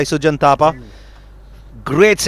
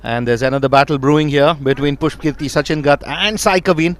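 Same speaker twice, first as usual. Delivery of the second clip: usual speaking voice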